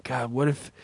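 ordinary talk

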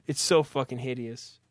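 ordinary talk, nothing marked

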